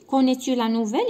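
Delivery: نوفيل rising at the end of the question; question tune. Shown as a demonstration. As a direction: ask it again, lifting نوفيل. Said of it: "هنا سي اون كيسيون". (نبرة سؤال على نوفيل)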